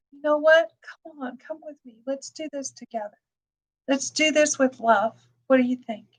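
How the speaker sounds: put-on soft, loving voice to a little kid; gentle coaxing. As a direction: coax the child along kindly and quietly. Gentle invitation to a child.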